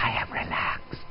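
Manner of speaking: soft unvoiced whisper. Shown as a demonstration. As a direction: breathe the words out with no voice.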